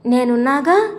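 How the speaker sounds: ordinary delivery, nothing marked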